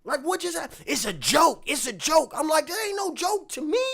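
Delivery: heated disbelief, voice climbing; losing my cool. Retold or imitated. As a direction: let the anger climb as you insist. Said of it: "I start losing my cool".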